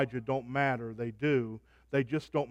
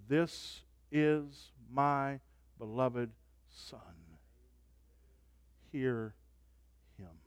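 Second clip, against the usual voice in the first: softer, slow, solemn quote; hushed voice of God; 2 semitones lower, 5 dB quieter, much slower than usual. Acted. Preacher voicing God from the cloud, softer and slow. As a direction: hush it with awe. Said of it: "The voice says".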